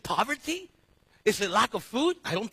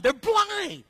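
outraged; 10 semitones higher, 5 dB louder than usual. Retold or imitated. Mock objection to Jesus' question.

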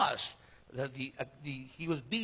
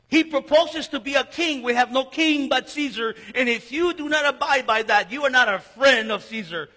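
loud, higher crowd ultimatum; crowd threat; 6 semitones higher, 9 dB louder than usual. Acted. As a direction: push Pilate into a corner.

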